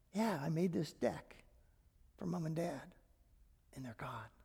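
fading to a whisper; hushed grief. Retold or imitated. Pastor recalling the deck built for his late parents, fading whisper. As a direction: let the words die away into a breathless whisper.

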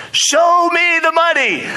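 high, drawn-out mock shout; exuberant demand. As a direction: holler it like a hyped-up client on the phone. Acted client demands money on phone.

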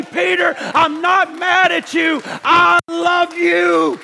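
loud, high, slow declaration of love; Jesus to Peter. Acted. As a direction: pour out forgiveness across the courtyard. Jesus' look speaks to Peter.

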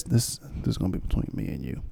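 hushed, confiding whisper; whispered aside. Real, not acted. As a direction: lean in and whisper it.

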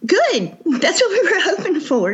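pleased, laughing reply, pitched high; delighted and chuckling. Real, not acted. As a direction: say it through a laugh, voice lifted. Pleased reply amid shared laughter.

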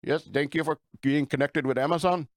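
put-on scam caller with an Indian accent; put-on Indian accent, phone-agent politeness; imitated Indian accent. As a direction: mimic an Indian call-center agent. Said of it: "some dude in India".

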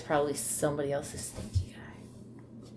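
soft wry whisper. Real, not acted. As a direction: whisper it knowingly. Wry aside.

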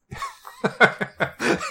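high, amused laughter; real laugh. Real, not acted. Laughing at a villain taunting a kid, real high laugh.

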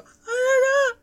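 high, loud "ah" cries; re-enacted cries. Fan re-enacts a reaction in the cinema, high cries. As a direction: cry out in short high bursts.

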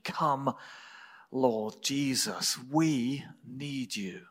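ordinary delivery

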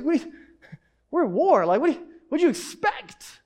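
lightly acted officer baffled by a crying recruit; mock exasperation.

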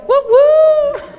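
high, loud cheering whoop; celebratory whoop. Real, not acted. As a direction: whoop it up in support. Cheering for another [name].

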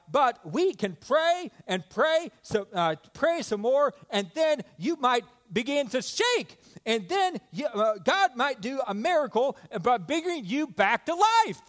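high, eager imitation of a little boy; imitated kid rattling off hopeful plans; excited child. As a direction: build with a child's earnest excitement. Voices young son's excited faith.

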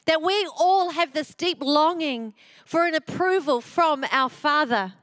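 ordinary talk

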